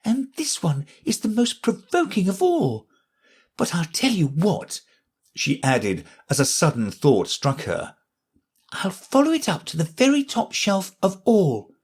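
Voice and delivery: put-on high girl voice, vexed then resolute; Alice chasing the thing on the shelves; determined Alice. A narrator's reading.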